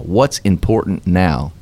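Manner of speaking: ordinary delivery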